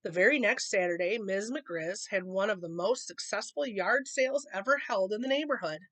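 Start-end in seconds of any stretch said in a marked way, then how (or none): none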